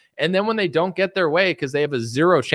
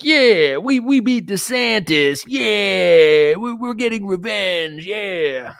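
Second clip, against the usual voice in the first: higher, louder dopey fan cheer; higher mock cheer of fans who think they won; 4 semitones higher, 4 dB louder, much slower than usual. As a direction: ham up gullible supporters celebrating. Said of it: "And everyone's like".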